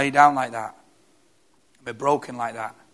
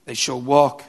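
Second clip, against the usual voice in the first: scripture reading; 3 semitones lower than usual. Acted. Preacher reading Isaiah to his church, solemn. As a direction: read the verse with solemn weight.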